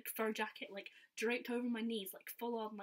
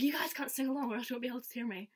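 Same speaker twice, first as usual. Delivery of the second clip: louder retold call to an audience; acted crowd address; 3 semitones higher, 8 dB louder than usual. Performer warning fans not to sing along, acted louder call. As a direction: raise your voice as the performer.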